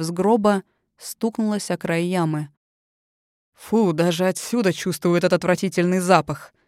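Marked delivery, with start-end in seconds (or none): 3.5-6.5 disgusted. A narrator's reading. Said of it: "скривился Генри".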